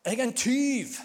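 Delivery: high, loud public confession; shouted confession. Acted. Sinner announcing theft to everyone around, shouted confession. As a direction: cry it out for all to hear. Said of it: "Vi trenger ikke rope".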